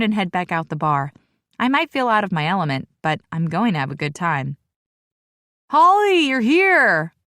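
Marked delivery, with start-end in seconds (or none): 5.7-7.1 high, loud happy shout. Acted. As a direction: call out to her over the noise. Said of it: "Hunter shouts".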